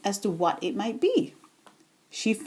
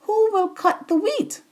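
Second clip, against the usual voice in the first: brisk, much higher call; calling briskly; 10 semitones higher than usual. A narrator's reading. Hen rounding up the animals, brisk high call. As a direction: bustle and call out quickly. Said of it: "calling briskly".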